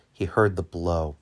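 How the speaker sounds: plain reading voice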